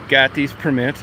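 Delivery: normal talking voice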